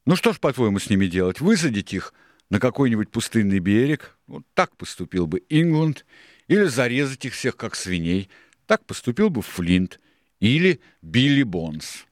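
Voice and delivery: loud, high-pitched, emphatic pirate oratory; Silver addressing the crew. A narrator's reading. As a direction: put the choices forcefully, punching each one. Reader voices Silver arguing.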